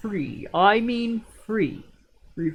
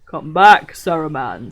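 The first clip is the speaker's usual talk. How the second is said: loud, firm command; 4 semitones lower, 8 dB louder than usual. A narrator's reading. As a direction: call him back with authority. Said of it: "in a commanding voice".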